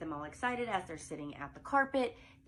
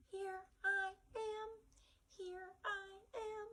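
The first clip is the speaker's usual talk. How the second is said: very high, soft falsetto mom voice; a high falsetto mother figure for preschoolers; female mom voice; 14 semitones higher, 6 dB quieter, slower than usual. Shown as a demonstration. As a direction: sing it up high like a sweet mommy. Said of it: "So it's gonna have a female voice".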